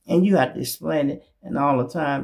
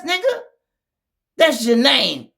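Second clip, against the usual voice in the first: much higher and louder on ain't; mock him loudly; loud jeer; 9 semitones higher, 5 dB louder than usual. Real, not acted. Calling him out.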